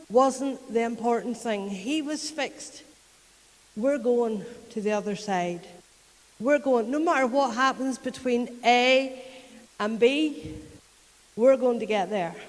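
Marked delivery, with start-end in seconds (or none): 3.8-12.3 louder, firm and resolute; preacher voicing a resolute Jesus, louder; determined. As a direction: declare it like nothing will stop you.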